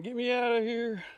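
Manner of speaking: high-pitched mock yell from the bottom of a hole; retold hollering. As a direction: imitate a man stuck down a pit calling for help. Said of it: "And he's hollering".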